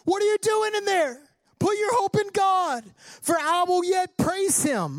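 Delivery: high, sustained preacher's shout; ringing self-exhortation at high pitch; shouting yourself into encouragement. Acted. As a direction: call it out high, scolding your own soul. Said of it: "you need to shout yourself into encouragement".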